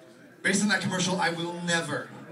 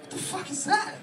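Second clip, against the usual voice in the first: much higher mock outrage; baffled outburst. Customer baffled by the Big Mac, squeaky mock outrage. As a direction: jump way up in disbelief. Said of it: "And I'm like".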